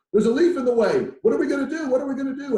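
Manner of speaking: high, loud frantic squeal; panicked ants. Retold or imitated. Ants squealing in panic at a leaf in their path. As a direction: play a panicking little ant. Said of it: "And they freak out".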